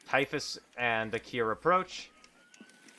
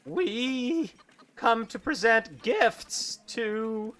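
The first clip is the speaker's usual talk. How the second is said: much higher, slow drawn-out claim; slow high bluff; 10 semitones higher, slower than usual. Acted. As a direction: oversell the gift story. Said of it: "Akir says".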